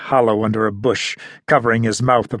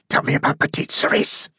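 menacing whisper; 3 dB quieter than usual. A narrator's reading. Interrogator presses a fourth time.

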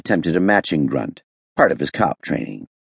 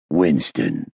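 low warning growl; 3 semitones lower than usual. A narrator's reading. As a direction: growl the name as a prompt. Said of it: "Hammond growled".

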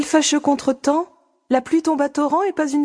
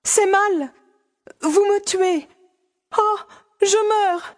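"c'est mal" pitched much higher, breaking into a plea; distressed cries; 7 semitones higher, slower than usual. Acted. Fanny overwhelmed in the countess's arms, high distressed cries. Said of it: "répondaient à ses cris".

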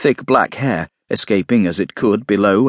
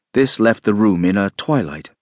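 ordinary delivery

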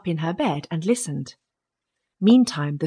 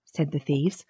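ordinary delivery

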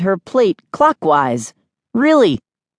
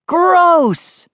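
high-pitched disgusted cry; shouted; 13 semitones higher than usual. A narrator's reading. Kid distracting sister mid-swing, shouting. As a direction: blurt out mock disgust. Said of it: "I shouted".

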